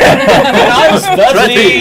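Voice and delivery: high, loud, slow mock host; game show host. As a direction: parody a booming quiz host, laughing. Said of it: "Sounded a bit like a game show host".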